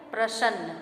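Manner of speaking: प्रसन्न said wrongly with a "sh" for the s, "prashann"; mispronunciation. Shown as a demonstration. Teacher showing students the wrong form, mispronounced with "sh". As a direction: say प्रसन्न as "pra-shann". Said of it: "यहाँ उच्चारण श और स में अंतर है".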